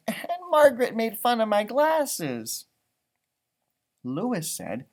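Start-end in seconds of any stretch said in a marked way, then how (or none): 0.1-2.7 Gilbert's excuse. Acted. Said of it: "Gilbert said".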